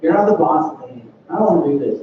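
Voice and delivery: put-on low, grumbling kid's complaint; lightly whiny, put-upon grumble; mock whining. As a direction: imitate a sulky child whining, pitched low. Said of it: "David didn't whine to his parents".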